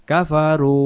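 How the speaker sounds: কাফারু with the final oo held long; one-alif stretch. Shown as a demonstration. Teacher showing the one-alif rule, long final oo in কাফারু. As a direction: draw out the last vowel of কাফারু. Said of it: "এক আলিফ টানতে হয়".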